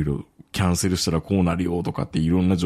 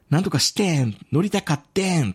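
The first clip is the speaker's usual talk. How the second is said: Kansai chat lines read out a bit higher; quoted Osaka dialect; 8 semitones higher than usual. Retold or imitated. Reading a coworker's chat messages, lightly mocking Osaka dialect. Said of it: "大阪弁喋るんですよ".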